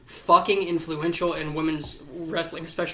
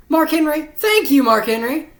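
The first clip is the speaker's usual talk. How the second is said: much higher, louder cheering thank-you; ecstatic shout; 10 semitones higher, 9 dB louder than usual. Real, not acted. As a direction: yell it like a fan in the crowd. Shouting thanks to Mark Henry.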